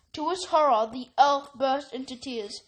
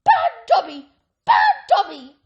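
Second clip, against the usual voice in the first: high, loud self-scolding shout; 10 semitones higher, 12 dB louder than usual. A narrator's reading. Elf punishes himself, shouting high and loud. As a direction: yell it at yourself in punishment.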